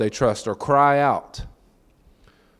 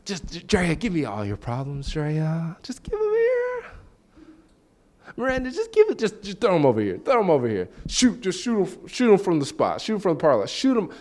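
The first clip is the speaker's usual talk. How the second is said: soft, higher, grinning plea; smiling eager friend; 4 semitones higher, 4 dB quieter than usual. Retold or imitated. Imagined friend begging Drea and Miranda for problems, smiling softly. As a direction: coax them to throw their problems over, smiling. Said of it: "Do they say it with a smile?".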